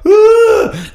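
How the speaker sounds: high, loud panicked yelp; hammed-up cry of alarm; mock scream. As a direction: yelp as if suddenly blinded at the wheel. Retold panic while driving blind.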